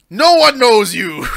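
dramatic. Retold or imitated. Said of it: "Stephen Strange has gone".

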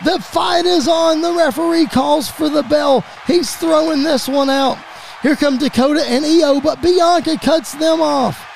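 high-pitched, urgent wrestling announcer; excited play-by-play. Acted. Commentator spots Sasha Banks storming the ring, excited play-by-play. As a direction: call a shock run-in as it unfolds. Announcer reacts to surprise run-in.

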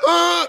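loud, high "ah"; hammed-up yell of pain; mock cry of pain. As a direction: wail as if floored by the blow. Pretends lying hurt on the floor.

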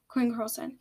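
usual speaking voice

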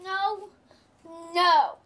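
loud protesting no; shouted no. Real, not acted. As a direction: yell the refusal. Child refuses losing toys.

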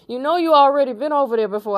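quick, high-pitched friend's quote; imitating my guy. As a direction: rattle off the friend's line up high. Acting out guy's quoted remark.

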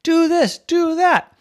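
high, loud, excited orders; coach yelling. Acted. Excited coach calls plays from the sideline, yelling. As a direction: yell out the plays. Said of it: "yelling the play".

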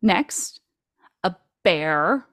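pause, then drop "a bear" in as if in quotes; sarcastic air quotes. Shown as a demonstration. Host doubting a computer-made bear, sarcastic air quotes. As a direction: set "a bear" apart, mock-serious. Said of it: "sarcastic air quotes 'cause it's a computer bear".

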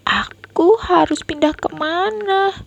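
put-on high worried child's question; a scared little boy at a loss; anxious child. Boy trapped by rising water asking, anxious.

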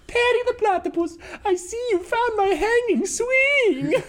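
very high cartoon villain, last word stretched; hammed-up accented villain, breaking into laughter; Doctor Doofenshmirtz. Imitating friend's joke in class, cartoon villain voice. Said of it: "He goes".

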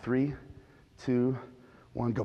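soft, low countdown under the breath; hushed countdown. Retold or imitated. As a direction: count it down quietly to yourself. Counting down at the stoplight.

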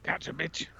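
hushed, unvoiced repeat of the catchphrase; whispered echo. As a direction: whisper it back like a secret. Echoing clip in a whisper.

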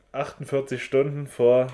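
ordinary talk, nothing marked

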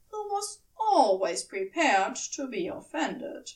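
usual speaking voice

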